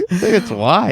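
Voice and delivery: high laugh into the words. Real, not acted. Laughing at own joke.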